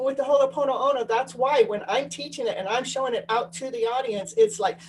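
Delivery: usual speaking voice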